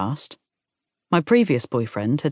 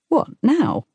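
much higher, startled question; surprised question; 11 semitones higher than usual. A narrator's reading. Fiancée told to put the ring on now, surprised. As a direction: pitch jumps up in surprise.

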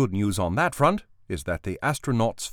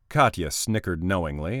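ordinary delivery

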